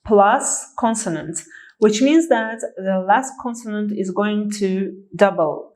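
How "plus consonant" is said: slow, spelled-out dictation; careful board-pointing pace; slow teaching demo. Shown as a demonstration. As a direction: lay each piece out slowly for the class. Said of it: "consonant plus vowel plus consonant".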